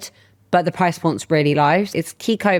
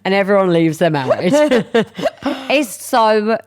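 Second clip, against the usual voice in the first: real laughter breaking up the words, pitched up; laughing; 2 semitones higher than usual. Real, not acted. Host jokes after reading the affirmation, laughing through it. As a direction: crack up while saying it. Cracking up at own joke.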